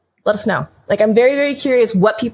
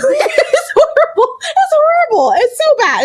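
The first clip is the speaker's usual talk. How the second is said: high, squealing through real laughter; helpless laughing confession; 4 dB louder, slower than usual. Real, not acted. Admitting her eye-colour prejudice, laughing hard. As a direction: laugh through the words, pitch way up.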